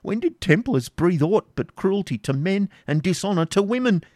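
put-on high anguished old father; agonised. Isaac accusing the Templars, anguished and high. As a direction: wring the words out in agony. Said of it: "wringing his hands in agony".

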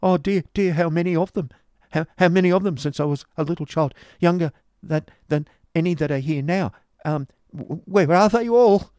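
high, stammering lament, rising to a wail; loud, high-voiced anguish of a broken boy; distressed cry. A narrator's reading. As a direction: cry it out, faltering, then wail the end. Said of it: "cried Smike".

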